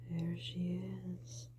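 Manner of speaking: slow unvoiced whisper; hushed whisper. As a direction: whisper it slowly with suspense. Spotting the witch.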